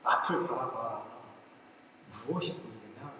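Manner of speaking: low, hushed voice quoting God; quiet divine voice. Retold or imitated. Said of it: "조용히 말씀을 하시더라고요".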